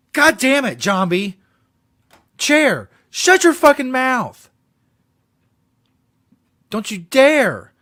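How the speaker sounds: hammed-up tantrum at the chair, slow at the end; mock rage. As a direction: parody of an angry screaming outburst. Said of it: "host mimics the angry Pee-wee".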